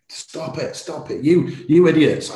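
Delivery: mock furious yell, pitched high; wife yelling at the bidders to stop. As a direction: hiss it then yell idiots. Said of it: "the swear words she was using".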